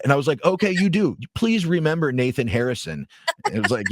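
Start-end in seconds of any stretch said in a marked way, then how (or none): 0.4-3.1 higher, pointed retort; mock-polite taunt. Volunteer answers the fan's threat to remember him, mock-polite taunt. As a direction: lightly goad him with exaggerated agreement.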